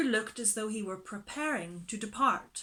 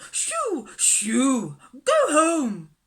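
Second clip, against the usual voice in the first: high, loud shooing cries; crying out; 5 semitones higher, 12 dB louder, much slower than usual. Acted. Miss Lark shooing the stray dog from her gate, crying out. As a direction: shoo the dog off shrilly. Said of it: "she cried".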